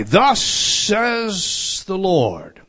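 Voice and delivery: thus says the Lord, drawn out very slowly; solemn proclamation. Acted. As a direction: intone each word like a prophet.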